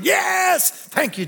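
high, exultant "yes", then easing down; jubilant cheer. Acted. Preacher voices his inward thanks to Jesus, jubilant cheer. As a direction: burst out cheering, then settle. Inner cheer acted out loud.

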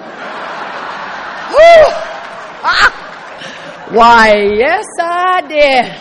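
loud, breathless relief with a laugh; relieved whew. Retold or imitated. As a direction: blow out relief, then a loud mock-proud yes. Relief at dodging surgery.

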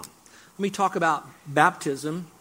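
ordinary talk, nothing marked

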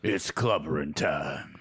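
low, slow gravelly tough guy; Thing voice. Acted. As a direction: rumble it out like a rock giant. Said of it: "you should be the fucking thing".

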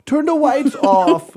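put-on a kid shouting his demand; loud child demand. Son Weston demanding darkness.

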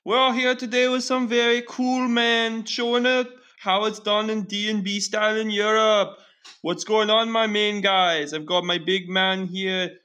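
high, hammed-up Jamaican podcast host; host greeting his guests in a put-on Jamaican accent; mock Jamaican accent. Podcast host opening the show in a hammed-up Jamaican accent. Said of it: "Those are all very good accents by everybody".

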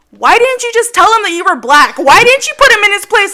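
a hammed-up outraged coworker, pushing back; coworker's indignant questions. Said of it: "he's like".

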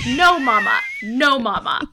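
loud, high whining no; whiny toddler protest. Retold or imitated. Mom imitating her daughter yelling back at her, loud and whiny. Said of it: "And then she's like".

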